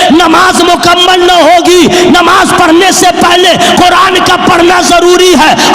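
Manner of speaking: high, loud rhythmic oratory; impassioned sermon shout, held high; full-force preaching. Real, not acted. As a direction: proclaim each phrase at the top of the voice.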